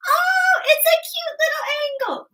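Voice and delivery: very high, loud squeal for a little angle; high-pitched cutesy voice. Shown as a demonstration. Teacher voicing a tiny angle as a memory trick, high-pitched. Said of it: "clearly not my high-pitched voice".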